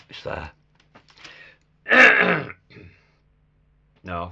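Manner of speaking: usual speaking voice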